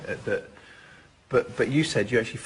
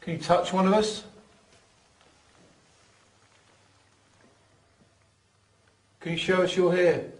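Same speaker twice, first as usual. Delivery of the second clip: higher, louder call to a spirit; talking to a ghost; 6 semitones higher, 4 dB louder than usual. Real, not acted. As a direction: ask the presence to show itself. Calling out to a spirit.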